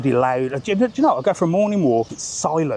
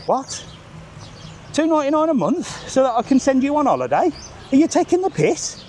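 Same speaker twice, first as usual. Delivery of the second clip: much higher, outraged disbelief; 10 semitones higher than usual. Real, not acted. Ranting about paid subscriptions, high outraged disbelief. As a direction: scoff at the very idea.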